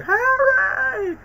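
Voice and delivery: high, loud, crying scream of the name; belted scream. Retold or imitated. Gamer imitating Peter screaming Harry's name, crying. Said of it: "shout every belt of the vocal cords".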